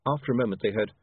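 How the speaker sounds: plain reading voice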